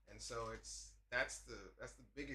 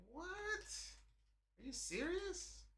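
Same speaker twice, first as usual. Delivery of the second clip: mock disbelief. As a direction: gape at the news. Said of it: "And I was like".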